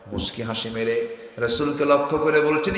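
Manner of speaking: ordinary talk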